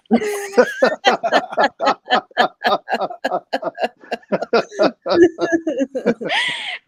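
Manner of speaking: long, high, loud laughing fit; helpless laughter. Real, not acted. Storyteller cracking up at her own punchline, helpless laughter. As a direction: crack up and keep going.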